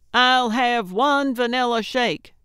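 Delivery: loud, high, sustained order; hollering. A narrator's reading. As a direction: holler it out the car window. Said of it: "she hollered out the window".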